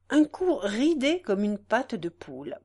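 plain reading voice

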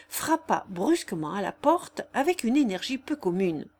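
usual speaking voice

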